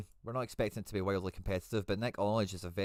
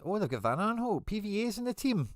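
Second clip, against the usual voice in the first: delighted surprise; 13 semitones higher, 4 dB louder than usual. Real, not acted. Delighted discovery in lineup.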